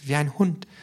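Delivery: high-pitched, alarmed character voice; startled. A narrator's reading. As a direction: say it in shock, high up. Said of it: "sagte das K erschreckt". (erschreckt, hohe Stimme)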